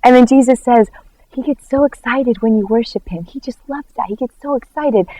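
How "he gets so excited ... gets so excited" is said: soft, delighted near-whisper; hushed confiding. Retold or imitated. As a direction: confide it softly, like a happy secret. Jesus confiding in her.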